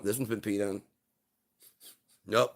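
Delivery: ordinary delivery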